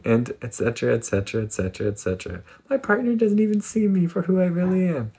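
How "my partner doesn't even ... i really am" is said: high-pitched anxious complaining; frantic whiny brain. Retold or imitated. The anxious mind griping about everyone, high and whiny. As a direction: squeak out the petty grievances. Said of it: "our brain is like".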